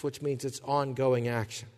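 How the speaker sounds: ordinary talk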